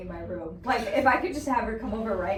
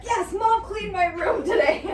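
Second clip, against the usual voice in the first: higher, slower, mock thrilled kid; kid delight; 9 semitones higher, 6 dB louder, slower than usual. Kid thrilled that mom cleaned the room, higher and excited. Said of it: "He'd be like".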